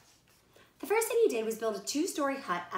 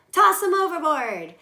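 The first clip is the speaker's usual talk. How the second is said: loud pirate yell, higher; 6 semitones higher, 11 dB louder than usual. Acted. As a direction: yell the order across the deck. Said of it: "he yelled at the other bunnies".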